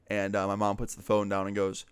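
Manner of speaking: ordinary talk, nothing marked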